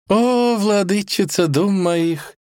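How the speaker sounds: high, slow swooning declaration; florid suitor. A narrator's reading. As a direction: gush theatrically, stretched out up high. Genie begins florid love speech.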